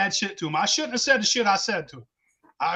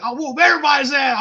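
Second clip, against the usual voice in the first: higher, louder mock threat; retold drunk bragging, raised voice; riled-up boast; 8 semitones higher, 5 dB louder than usual. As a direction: puff up and yell the threat. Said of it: "I was fucking riled up".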